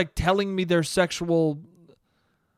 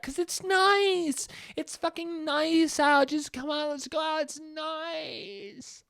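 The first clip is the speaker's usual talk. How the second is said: much higher, slow dopey drawl; dopey mock voice; 11 semitones higher, slower than usual. Mocking a friend who thinks nice weather stops covid. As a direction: play him as blissfully dim.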